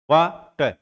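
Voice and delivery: "वा… टर" in two separate beats; split into syllables. Shown as a demonstration. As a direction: pause between "वा" and "टर" in "वाटर". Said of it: "तो वाटर में कितने syllable है".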